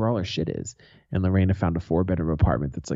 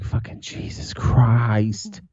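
slow whispered exasperation; exasperated whisper; 2 semitones higher, much slower than usual. Real, not acted. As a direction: breathe it out slowly in disbelief. Exasperation about rent.

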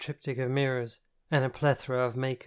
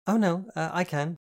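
flustered; 6 semitones higher, 5 dB louder than usual. A narrator's reading. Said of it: "I offered quickly".

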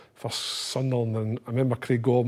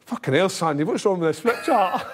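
higher, louder mock rant; mock angry manager; 7 semitones higher, 4 dB louder than usual. Manager raging at the ripped flip chart, hammed-up anger. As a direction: bark it like a fuming gaffer.

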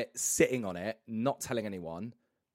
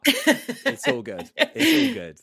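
squeaky high; 11 dB louder, much slower than usual. Acted. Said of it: "laughing apology".

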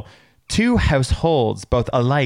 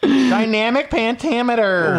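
higher and slow, hammed-up; mock grand announcing; 8 semitones higher, slower than usual. Mimicking his cohost, slow high grand delivery. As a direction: stretch it out like a proclamation. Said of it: "you said that to me all the frickin' time".

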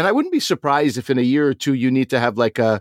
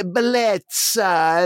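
drawn-out, higher mock Italian "bellezza"; teasing a friend by imitating his Italian flourishes; 6 semitones higher, much slower than usual. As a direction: stretch "bellezza" out slowly like an over-the-top Italian. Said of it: "Marco is like".

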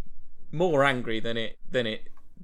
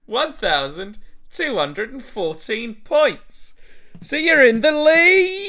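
excited announcer; 9 semitones higher, 6 dB louder than usual. Acted. Gameshow host announcing score.

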